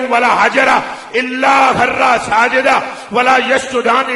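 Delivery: loud, fervent sermon delivery, almost shouting; preaching at full force. Acted. Preacher telling the congregation about the Prophet, at full shouting force.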